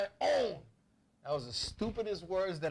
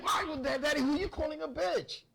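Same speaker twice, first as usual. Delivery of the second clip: put-on indignant kid; 9 semitones higher than usual. Child talks back to father.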